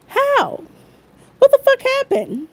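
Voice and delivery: high, incredulous cry of disbelief; outraged disbelief. Real, not acted. Outraged at the neighbors' story, a high incredulous cry. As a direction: shoot way up in pitch, stunned and angry.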